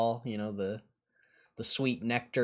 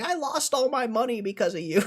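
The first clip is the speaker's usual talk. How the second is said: angry viewer; 13 semitones higher, 5 dB louder than usual. Pretend. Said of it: "someone come in here and be like".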